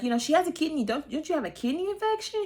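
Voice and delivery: incredulous high question. Retold or imitated. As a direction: ask it high, teasing. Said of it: "So Andy's like".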